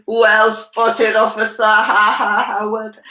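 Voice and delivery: loud joking retort with a ha-ha laugh; cheeky mock praise. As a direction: play the joker mocking an officer, loudly. Cheeky passenger answers policeman, retold.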